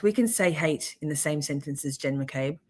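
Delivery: ordinary delivery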